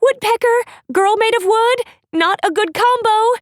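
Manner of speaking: put-on a frightened girl shouting for help. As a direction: yell for help while fleeing. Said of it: "Cedar shouted".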